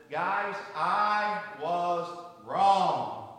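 very slow, each word weighed; slow solemn confession. Retold or imitated. Father confessing to his three kids, very slowly and deliberately. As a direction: confess it slowly to the whole family. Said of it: "Your dad has something to tell you".

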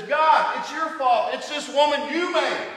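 loud, high, indignant excuse; defensive blame. Acted. Adam protesting loudly to God, defensive and indignant. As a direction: shove the blame back at God.

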